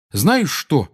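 mildly heated challenge. A narrator's reading. Seamus turning on Ron, heated. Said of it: "разгорячённо сказал ему Симус".

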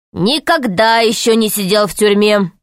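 plain reading voice

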